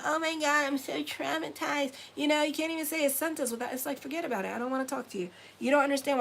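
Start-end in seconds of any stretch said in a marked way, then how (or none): 0.0-1.9 higher-pitched mocking whine; mock distress, pitched up; mock traumatized person. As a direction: imitate an oversensitive complainer. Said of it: "Don't be saying".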